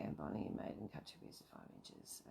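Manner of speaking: whispering the instructions to herself; whispered reading. Shown as a demonstration. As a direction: read it under your breath. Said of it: "read your own instructions".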